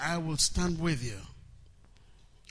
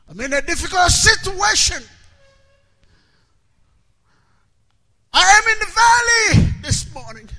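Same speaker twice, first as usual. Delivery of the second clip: very high, full-force pulpit shout; high, ringing preacher's shout; shouted declaration; 13 semitones higher, 11 dB louder than usual. Shown as a demonstration. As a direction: cry it out loud for the whole congregation. Preacher shouts model declaration to congregation.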